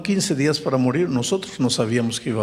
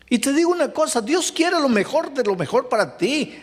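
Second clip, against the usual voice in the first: higher, rousing preaching call; full-force preaching; 11 semitones higher than usual. Acted. Pastor assuring the congregation that God gives life, rousing. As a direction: lift the congregation with bold conviction.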